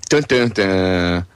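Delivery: slow, spaced-out "dun, dun, dun"; mock suspense sting. Host announces Gary is on the list, mock-dramatic sting. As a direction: voice a drawn-out dramatic reveal sting.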